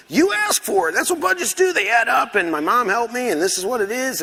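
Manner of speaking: high-pitched flustered justifying; acted-out panicky self-defence; defensive protest. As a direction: squeal your excuses, pitch way up then easing down. Acted defensive protest over budget.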